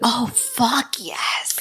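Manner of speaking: slow, breathless whisper. Real, not acted. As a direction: hush it out in awe. Excited reaction to "night witches.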